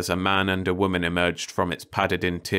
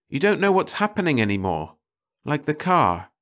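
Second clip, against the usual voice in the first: lightly voiced woman, pitched up; a hint of an anxious housewife; fretful woman; 6 semitones higher, 3 dB louder than usual. A narrator's reading. Woman's complaining speech voiced.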